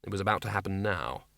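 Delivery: low, quiet reveal; hushed dramatic. A narrator's reading. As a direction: let it land softly. Dramatic reveal of imminent event.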